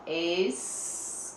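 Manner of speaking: "Escasso" slow with a long hissed S; S sound shown. Shown as a demonstration. Teacher showing pupils the S sound, drawn out. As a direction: hold each syllable of "Escasso" apart. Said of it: "O S tá com o som do S".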